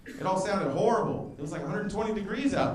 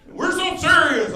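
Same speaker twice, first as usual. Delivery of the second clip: mock serious Marine; 10 semitones higher, 8 dB louder, slower than usual.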